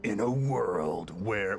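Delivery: low, slow epic trailer narrator; movie trailer voice. Retold or imitated. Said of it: "the movie trailer guy goes like".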